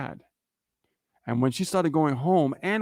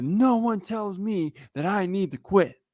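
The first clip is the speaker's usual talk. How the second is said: defiant wife; 5 semitones higher, slower than usual. Retold or imitated. Wife's defiant retort, retold.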